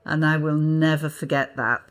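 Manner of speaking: usual speaking voice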